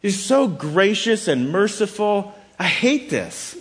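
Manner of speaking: high-pitched, exasperated mock whining; hammed-up petulant protest; ridiculous complaint. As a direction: gripe as if kindness were an outrage. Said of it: "it's supposed to sound ridiculous".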